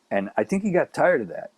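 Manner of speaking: usual speaking voice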